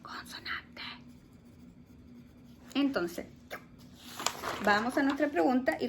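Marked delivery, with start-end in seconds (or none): 0.0-0.8 barely audible whisper; whispered hint. Shown as a demonstration. Teacher feeds pupils the consonant rhyme answer, whispered. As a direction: give away the answer in a whisper.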